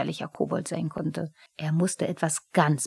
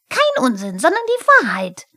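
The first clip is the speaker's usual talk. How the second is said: triumphant high-pitched shout; loud, rising kobold triumph; Pumuckl; 8 semitones higher, 8 dB louder than usual. A narrator's reading. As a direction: crow it loudly in victory. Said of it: "triumphierte Pumuckl".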